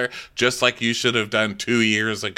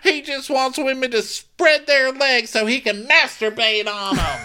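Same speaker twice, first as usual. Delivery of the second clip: high, loud hammed-up shout; mock yelling Taylor; 4 dB louder than usual. Podcaster imitating Taylor shouting, high loud mock shout. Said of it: "And she goes".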